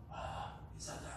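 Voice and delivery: barely voiced, breath-only whisper; weak post-surgery voice. Shown as a demonstration. Patient just after abdominal surgery addressing the chairman, weak whisper. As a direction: strain out a feeble greeting with no voice. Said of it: "소리가 잘 안 나와요".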